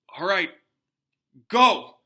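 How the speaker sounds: teacher calling loudly to start the test. A narrator's reading. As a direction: fling out the command. Lupin opens the case.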